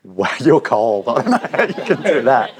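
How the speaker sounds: amused mock shock, slowed down; mock incredulous. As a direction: play disbelief at a cold kid. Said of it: "and I'm like".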